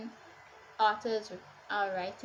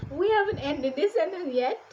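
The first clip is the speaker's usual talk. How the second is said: higher, louder incredulous outburst; exasperated disbelief; 6 semitones higher, 8 dB louder than usual. Retold or imitated. Reader stuck in an unending sentence, exasperated disbelief. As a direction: exclaim in comic disbelief. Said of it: "I was like".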